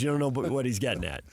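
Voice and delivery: ordinary talk, nothing marked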